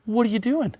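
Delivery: high, mock-suspicious demand; hammed-up jealous husband confronting his wife; accusing question.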